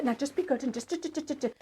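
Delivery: soft, anxious nagging that trails off; a hushed string of do, do, do; tense hushed nagging. Retold or imitated. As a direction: mum tensely hushing her child, fading to a murmur. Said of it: "all her body language".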